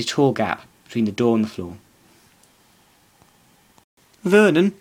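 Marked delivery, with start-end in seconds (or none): 4.2-4.7 high, louder, trembling cry of "Vernon"; quivering voice. Acted. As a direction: call out shakily, as if frightened. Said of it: "saying in a quivering voice".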